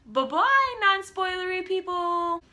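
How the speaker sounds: high, playful bye-bye; sing-song goodbye. Real, not acted. Sending spoiler-averse viewers away with a playful high goodbye. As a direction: wave them off cheerfully.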